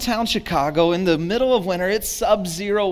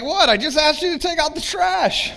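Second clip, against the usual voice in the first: indignant surprise; 11 semitones higher than usual. Retold or imitated. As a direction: protest that it was a simple ask. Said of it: "I'm like".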